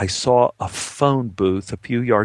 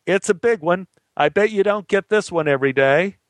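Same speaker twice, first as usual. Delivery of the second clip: raised pitch, mild mock yell; yelling back; 6 semitones higher than usual. Man answers cops across the chasm, mild yell. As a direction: call it back across a distance without real volume. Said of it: "I yelled back".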